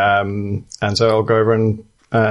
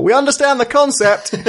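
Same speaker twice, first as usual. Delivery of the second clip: a much higher, laughing yell over the shoulder; mock yell; 13 semitones higher than usual. The player's bird mocking the guard while retreating. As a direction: shout back cheekily while retreating. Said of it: "I'd like to yell".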